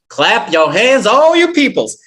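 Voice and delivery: high, loud Southern preacher's cry; Southern Baptist preacher. Acted. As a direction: rouse the congregation in a ringing Southern accent. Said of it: "I just had that Southern accent".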